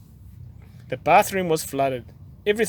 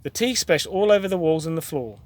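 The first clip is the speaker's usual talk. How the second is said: plain reading voice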